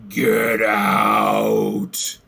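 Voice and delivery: drawn-out guttural "get out"; guttural mock scream. Game master voicing an unseen thing in the room, guttural. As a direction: growl it slowly, a restrained monster scream. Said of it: "scream at you gutturally".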